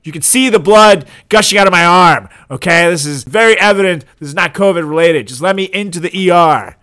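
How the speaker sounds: mock-furious patient yelling at staff; exasperated shouting. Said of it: "It's like".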